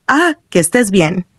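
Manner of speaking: plain reading voice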